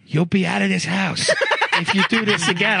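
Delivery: mock Italian-American threat, breaking into a laugh; Italian guy accent. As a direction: lightly imitate an Italian-American parent's warning. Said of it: "he talked like this, Italian guy".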